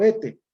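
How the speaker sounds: "ete" said with the s dropped; "ete" with no s before the t. Shown as a demonstration. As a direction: demo "ete" as the coastal speakers say it, no s before the t. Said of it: "en vez de este".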